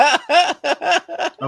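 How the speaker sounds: slow words through laughter; laughing slowly. Real, not acted. Mocking a viewer's wish, slow and laughing. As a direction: drag it out amused.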